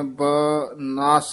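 ਬਨਸ said slowly, syllable by syllable; split syllables. Shown as a demonstration. Teacher breaking the word into parts, slowly. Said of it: "dash ਦੇ ਦੇਣੀ ਹੈ".

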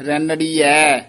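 ਰੈਣੜੀਐ ending in a long open ai vowel, stressed; ਰੈਣੜੀਐ with a clear ai ending; ending ai sound. Shown as a demonstration. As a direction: stretch the final ai of ਰੈਣੜੀਐ. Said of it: "ਐੜੇ ਦਲਾਵਾਂ ਹਨ".

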